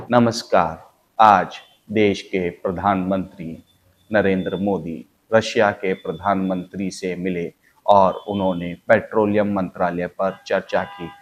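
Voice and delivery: put-on slow, measured old Doordarshan newsreader; slow formal TV news bulletin; slow news read. Shown as a demonstration. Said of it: "तो ये speed बड़ी कम थी".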